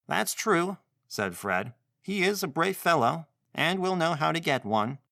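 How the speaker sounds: put-on a young boy speaking bravely. Fred speaks.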